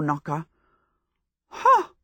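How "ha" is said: sharp high scornful ha. A narrator's reading. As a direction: scoff in triumph. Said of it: "said Sophie".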